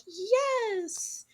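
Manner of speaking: very high mock teenage squeal; swooning fangirl. Underage girls crushing on Hollywood star, mock squeal. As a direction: gush like a hammed-up fangirl. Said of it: "underage girls would be like".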